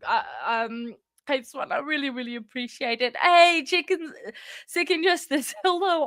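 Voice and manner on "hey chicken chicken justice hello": high, bright-pitched hello with a laugh; excited greeting. Real, not acted. Streamer greeting an arriving viewer, excited. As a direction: call out gladly to a newcomer.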